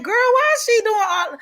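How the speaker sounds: put-on high-pitched incredulous aside to a girlfriend; mock incredulity. Retold inner reaction to therapist's praise.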